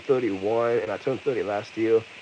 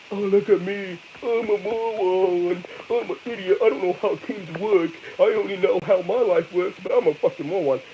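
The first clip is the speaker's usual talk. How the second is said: higher, whiny troll parody; mock the trolls as dimwits; parodying the trolls who mock him, higher and whiny; 7 semitones higher than usual. Mocks trolls in their voice.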